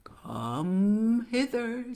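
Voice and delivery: very slow, rising, loud beckoning; luring crocodile. A narrator's reading. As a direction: stretch "come" out long and high. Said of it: "said the crocodile".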